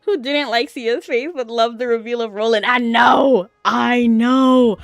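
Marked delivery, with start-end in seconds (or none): 2.7-4.8 loud, thrilled exclamation; real excited agreement. Real, not acted. Streamer agreeing with chat about loving the reveal, loudly excited. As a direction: burst out in eager agreement.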